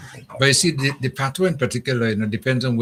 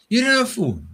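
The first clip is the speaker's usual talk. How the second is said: put-on kid's disbelief; 12 semitones higher than usual. Kid asking in disbelief.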